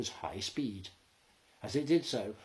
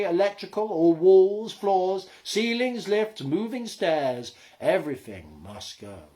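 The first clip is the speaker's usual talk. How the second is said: solemn proclamation; 6 semitones higher, 10 dB louder than usual. A narrator's reading. Arnold Brothers' proclamation.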